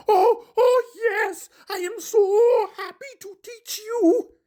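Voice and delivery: high, loud monster voice, teary with joy; high puppet voice, choked-up delight; overjoyed Grover. Acted. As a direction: burst out happy through leftover tears. Grover overjoyed after crying.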